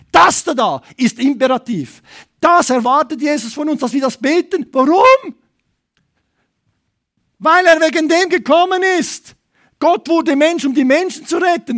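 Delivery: building to a high, loud cry; fervent shouted sermon climax; full-force preaching. Real, not acted. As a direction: proclaim it with burning urgency.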